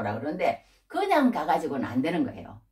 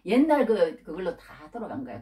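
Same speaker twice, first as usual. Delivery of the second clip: ordinary talk, nothing marked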